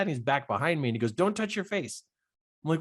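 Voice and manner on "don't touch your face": retold dad's yell. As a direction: imitate Dad calling out urgently, pitch raised. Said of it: "my dad yells to me".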